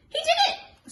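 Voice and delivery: very high, loud, laughing parrot squawk. Acted. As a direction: screech it like a cheeky parrot. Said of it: "squawked the silly bird".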